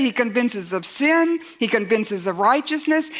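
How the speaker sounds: ordinary delivery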